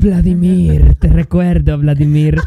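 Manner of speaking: low, forceful mock Russian accent. Host fondly recalling a Russian lover, mock Russian accent. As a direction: play a Russian-accented lament, deep and loud. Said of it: "como uno ruso de que Vladimir".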